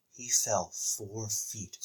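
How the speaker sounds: hushed, drawn-out gravity; somber announcer. Retold or imitated. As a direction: imitate a grave announcer, slow and soft. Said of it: "it was all somber".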